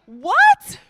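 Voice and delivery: high, loud, shocked "What?"; hammed-up disbelief at the page; mock outrage. Reader pretending the book shocked him, high and loud.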